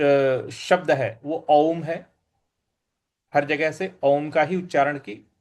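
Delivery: normal talking voice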